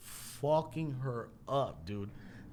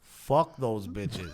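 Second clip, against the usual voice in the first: lower, slow, deadpan driver voice; gruff driver; 6 semitones lower, much slower than usual. Retold or imitated. The driver telling [name] to forget the girls, low and slow. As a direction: flatly overrule him, taking your time. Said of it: "He looked at him and said".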